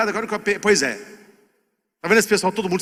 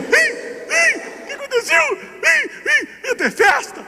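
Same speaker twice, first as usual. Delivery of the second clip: put-on very high whimpering child voice; little boy crying; slower than usual. The boy asks his mother about the party, high whimpering child. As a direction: whine it like a small boy about to cry. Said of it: "O menino sai chorando".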